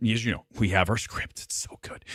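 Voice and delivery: hushed conspiratorial whisper; breathy aside, barely voiced. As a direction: whisper it like a secret to the viewers. Secret script aside to viewers.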